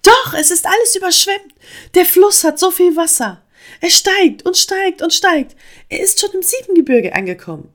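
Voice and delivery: very high, loud, agitated frog; ganz aufgeregt. A narrator's reading. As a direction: blurt out the alarming report. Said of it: "erzählte Fridolin ganz aufgeregt". (hoch, laut und aufgeregt erzählen)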